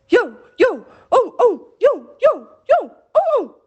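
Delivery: high, loud baying yo yo; bay it out like hunting hounds. Acted. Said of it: "at the top of their voices".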